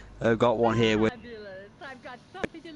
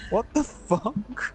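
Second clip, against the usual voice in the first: put-on slow, high, stunned old man; slow bewildered pensioner; baffled old man; 9 semitones higher, much slower than usual. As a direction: drag it out in total disbelief. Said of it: "this old man's just like".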